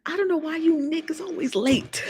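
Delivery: teasing scold, whispered. Acted. Host teasing late viewers, whispered scold. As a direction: hiss the complaint under breath.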